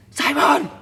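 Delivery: loud, high call across the pitch; mimicked sharp bellow; imitated manager's shout. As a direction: yell the name like an angry coach.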